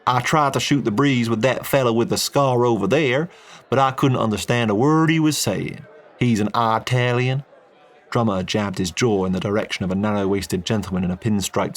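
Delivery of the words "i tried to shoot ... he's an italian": easy Texan oil man, friendly and blunt; a folksy Texan businessman chatting. Acted. Texan complains about Italian.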